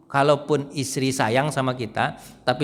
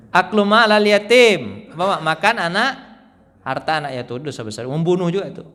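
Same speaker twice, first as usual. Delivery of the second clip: normal talking voice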